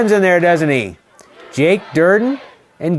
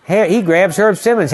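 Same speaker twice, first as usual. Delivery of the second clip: ordinary talk, nothing marked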